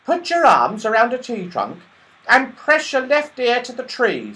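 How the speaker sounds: high, loud, sudden little squirrel. A narrator's reading. Squirrel suddenly telling the children, high and loud. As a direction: pop in high and eager like a squirrel. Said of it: "said the squirrel suddenly".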